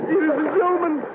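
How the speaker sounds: shrill cartoon panic, as if sped up; frantic panic.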